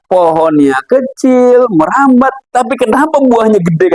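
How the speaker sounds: drawn-out start climbing to a high question; acted puzzlement. Man compares a small vine with huge watermelons, acted puzzlement. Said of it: "kata dia".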